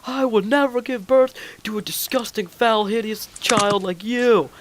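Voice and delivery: high, theatrical tirade in imitation of a teacher; hammed-up high-pitched outrage; mock teacher scream. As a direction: mimic an appalled teacher, drawing out the insults. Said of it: "started to scream at him".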